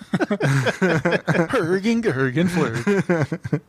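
hammed-up fake Swedish nonsense words; mock Swedish. Teasing a friend's Swedish pick, mock Swedish accent. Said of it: "like, Swedish".

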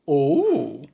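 ordinary delivery

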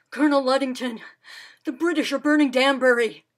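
loud breathless warning; urgent alarm. Acted. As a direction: burst in with terrible news. Breathless messenger bursts in.

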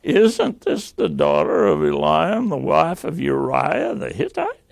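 "Hittite" rising at the end as a question; questioning tune. Shown as a demonstration. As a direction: read it as a question, lifting on "Hittite". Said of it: "It ends with a question mark".

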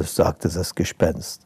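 plain reading voice

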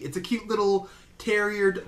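ordinary talk, nothing marked

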